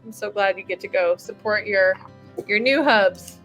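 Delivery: ordinary delivery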